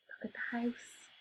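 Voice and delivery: put-on hushed whisper to the children. A narrator's reading. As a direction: whisper in shock over the ruin. Teller hushes for effect.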